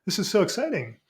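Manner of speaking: high-pitched mock wonder; lightly hammed-up delight; acted excitement. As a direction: play thrilled at a new view. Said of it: "And you're like".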